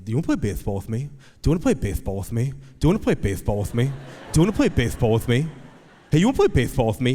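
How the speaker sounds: rapid, repetitive lisped pestering; lisping four-year-old. Retold or imitated. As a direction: pester dad like a lisping little boy, fast. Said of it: "he has a lisp right now".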